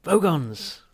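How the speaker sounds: high, loud cry of alarm; alarmed shout. Acted. Ford warning of danger, loud alarmed cry. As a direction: blurt the name out in alarm.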